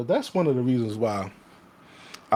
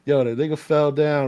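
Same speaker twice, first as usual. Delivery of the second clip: ordinary talk, nothing marked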